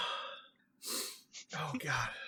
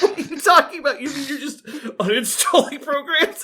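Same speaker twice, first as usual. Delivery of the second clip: incredulous, higher, through laughter; laughing disbelief; 6 semitones higher than usual. Retold or imitated. Quoting herself to friend, laughing disbelief.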